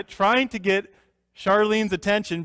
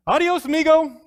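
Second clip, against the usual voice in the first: high, loud showy farewell; jaunty goodbye; 10 semitones higher, 7 dB louder than usual. Retold or imitated. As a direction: toss it off like a cocky deputy. Deputy's parting line, acted.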